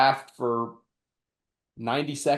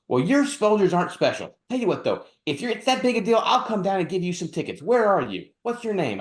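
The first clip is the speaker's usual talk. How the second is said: snippy woman; 7 semitones higher than usual. Retold or imitated. Retold snippy woman on phone.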